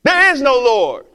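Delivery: loud mocking shout back; yelled retort. Atheist neighbor yelling back at the old lady, mocking shout. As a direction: fling it back across the yard. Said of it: "he'd yell back".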